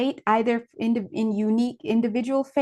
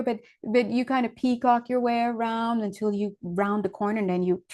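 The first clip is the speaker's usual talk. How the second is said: normal talking voice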